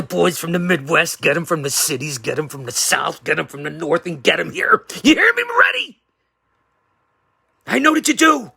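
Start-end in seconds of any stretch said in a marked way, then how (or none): 5.0-8.5 high, loud and fast; a wrestling heel's calling out; shouted callout. Acted. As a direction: yell it across the room at him. Calling out to rival.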